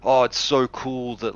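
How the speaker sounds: put-on wide-eyed enthusiasm; mock casual-content host. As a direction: gush slowly in parody. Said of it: "We're not gonna do the".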